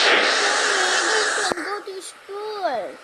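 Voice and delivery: play-acted child's protest, stretched out; pretend reluctant child. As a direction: whine slowly like a kid refusing to go. Child voiced refusing to go to school.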